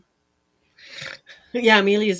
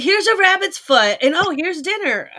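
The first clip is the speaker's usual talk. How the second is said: higher, louder mock-cheerful offer; mock cheerful relative; 9 semitones higher, 5 dB louder than usual. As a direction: play the cheerful cruel relative serving the pet.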